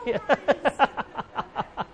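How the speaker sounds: long high chuckle; trailing laugh. Real, not acted. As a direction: let the laughter run on and fade. Laughs at own teasing.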